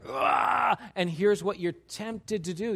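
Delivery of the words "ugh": frustrated grunt. Retold or imitated. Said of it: "you're like".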